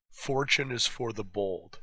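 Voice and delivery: ordinary talk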